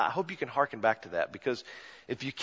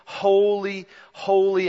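higher and louder, ringing out each holy; fervent worshipful proclamation; proclaimed holy; 6 semitones higher, 7 dB louder, slower than usual. Acted. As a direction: lift each holy up like a hymn of praise. Quoting the heavenly hymn aloud.

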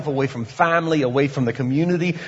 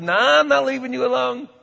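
higher, fast insistent reply; God's persistence; 9 semitones higher, faster than usual. Retold or imitated. God answering the sinner, fast high insistent. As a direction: refuse to let go, insistent.

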